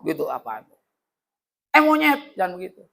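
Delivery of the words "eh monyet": mock startled yelp. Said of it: "jangan kayak di konten-konten tuh".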